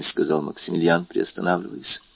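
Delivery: usual speaking voice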